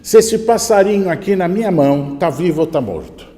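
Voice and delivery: loud, high taunting challenge; shouting youths. Acted. Youths challenge the sage from across the square, shouting. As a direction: call out across a crowded square. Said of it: "os jovens gritam".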